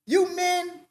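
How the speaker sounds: ordinary talk, nothing marked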